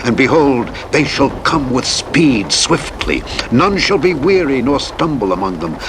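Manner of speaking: usual speaking voice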